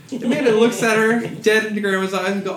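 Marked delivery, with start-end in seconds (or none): none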